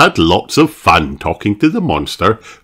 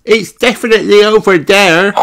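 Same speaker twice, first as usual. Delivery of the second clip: high, insistent character voice; excited insistence; 13 semitones higher, 4 dB louder than usual. A narrator's reading. Passengers searching all over the loch, excited and insistent. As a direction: insist on it, pitched way up. Passengers dispute where monster is.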